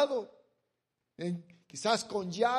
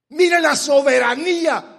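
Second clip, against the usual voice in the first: loud, higher proclamation; preaching shout; 7 semitones higher, 15 dB louder than usual. Real, not acted. Preacher exclaims to congregation, loud. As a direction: proclaim God's sovereignty.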